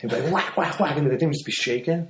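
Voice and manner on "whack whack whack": high punchy whack whack whack sounds; imitated whacks. Telling a friend about the roommate punching his post, sharp high whacks. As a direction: mimic fists hitting a wooden post, sharp and high.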